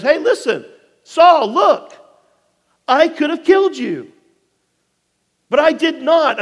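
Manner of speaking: high, loud call across a distance. Acted. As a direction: shout it out so he hears you from afar. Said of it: "he calls out to Saul".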